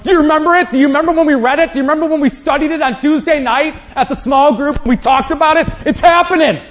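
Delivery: high, loud, thrilled nudging of a friend; tapping people aside at the resurrection, high excited voice. Pretend. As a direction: burst with excitement, tapping someone's shoulder. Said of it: "Be like".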